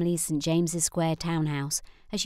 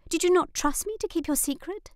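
put-on high, hurt girlish voice; a younger sister stung at being left out; young sister Lizzie; 10 semitones higher, 3 dB louder than usual. A narrator's reading. Sister asks Nora why she kept it secret, high and hurt.

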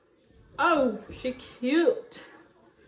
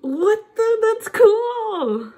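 high, loud astonished cry; drawn-out, high-pitched wonder; loud amazement; 9 semitones higher, 6 dB louder, much slower than usual. Real, not acted. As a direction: exclaim in thrilled disbelief. Amazed by colour change.

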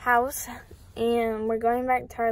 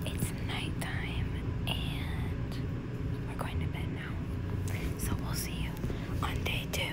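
hushed, unvoiced-breath whisper; bedtime whisper; 10 dB quieter than usual. Real, not acted. Signing off to the vlog before bed, whispering. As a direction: whisper a sleepy-hour sign-off, very softly.

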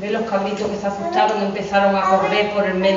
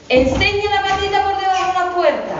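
put-on higher kid-goat voice giving an order; higher goat kid testing the wolf; little goat kid; 9 semitones higher than usual. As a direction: demand to see the paw, like a clever little goat. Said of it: "le dice los cabritos".

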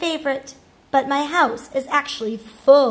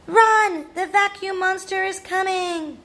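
alarmed warning; 6 semitones higher than usual. Acted. Rascal warns of the vacuum monster, alarmed warning. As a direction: shout a playful high-pitched alarm.

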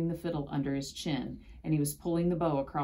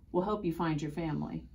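usual speaking voice

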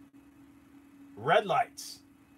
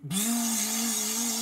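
a long, higher buzzing hum like a swarm of bees; imitated buzzing; 7 semitones higher than usual. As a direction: buzz like approaching bees. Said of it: "it sounds like a swarm of bees".

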